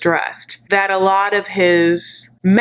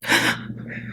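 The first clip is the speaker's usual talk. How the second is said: mock-shocked breathy gasp; scandalized nun gasp; 7 dB quieter than usual. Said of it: "like, some nun".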